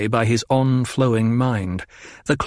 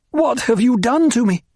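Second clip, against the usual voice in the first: high, loud accusing demand; 11 semitones higher, 3 dB louder than usual. A narrator's reading. Paul confronting his mother, high demanding accusation. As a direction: demand an answer. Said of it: "he demanded".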